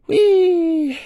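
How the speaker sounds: cheering for himself after the run; joyful whoop. Real, not acted. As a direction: let out a gleeful yell.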